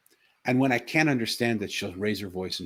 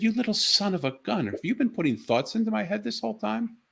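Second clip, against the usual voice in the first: much higher, excited disbelief; a father stunned his daughter has been talking to him all along; amazed outburst; 6 semitones higher, 4 dB louder than usual. Retold or imitated. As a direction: exclaim it in astonished wonder.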